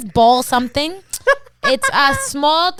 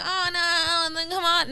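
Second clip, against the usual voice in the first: softer, higher singsong "oh no"; mock protest; 4 semitones higher, 5 dB quieter than usual. Friend imitating the fuss she would never make, mock protest. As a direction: put on a half-sung little protest.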